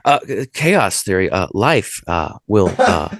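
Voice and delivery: halting movie-star imitation, full of uhs; put-on hesitant, broken-up phrasing; Jeff Goldblum. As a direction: stammer thoughtfully between each word. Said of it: "The Jeff Goldblum".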